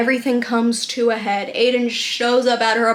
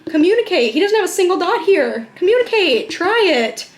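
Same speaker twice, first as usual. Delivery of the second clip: exasperated urging; 7 semitones higher, 3 dB louder than usual. Real, not acted.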